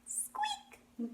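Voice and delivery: very high-pitched little squeak; mouse squeak. Shown as a demonstration. Teacher showing children the mouse sound, tiny high squeaks. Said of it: "and say".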